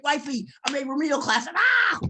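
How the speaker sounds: proudly shouting the news down the phone; excited call. Retold or imitated. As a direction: tell your wife the great news, overjoyed. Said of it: "I'm calling my wife".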